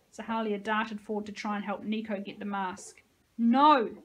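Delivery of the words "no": loud sobbing cry of refusal; sobbed. Acted. Josie sobs her refusal at Nico and Sahalia. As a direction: cry it out, backing up. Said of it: "Josie sobbed".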